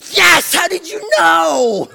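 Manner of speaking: high, drawn-out jubilant yell; excited shout. Retold or imitated. Grandpa confirming the kids' guess, excited shout. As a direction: explode with delight after the hush.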